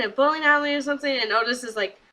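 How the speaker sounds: normal talking voice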